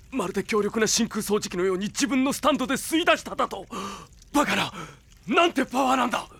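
rising into stunned alarm; shocked outburst. Acted. Villain stunned as his stand is pulled out.

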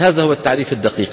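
normal talking voice

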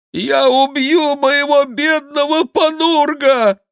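high, loud, drawn-out wail; plaintive cry. A narrator's reading. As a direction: wail slowly to win sympathy. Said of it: "жалобно кричал монах". (жалобный протяжный крик монаха)

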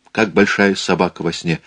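usual speaking voice

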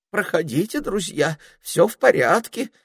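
high trembling voice. A narrator's reading. Sentry waving the friends through, trembling. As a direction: let them pass, shaking. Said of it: "проговорил часовой дрожащим голосом".

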